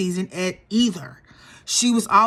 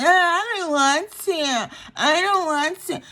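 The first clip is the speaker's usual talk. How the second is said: put-on higher, slow whiny baby voice; mocking baby voice; 7 semitones higher than usual. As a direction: whine like a sulking toddler. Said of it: "You know, baby voice".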